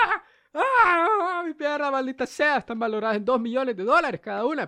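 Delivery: high, loud, hammed-up wailing; mock pain scream. Diver wailing about his priceless legs, mock high loud cries. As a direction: ham it up like a diver clutching his leg. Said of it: "Así estaba gritando".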